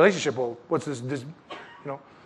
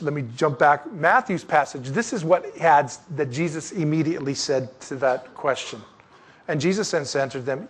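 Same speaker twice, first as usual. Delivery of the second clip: usual speaking voice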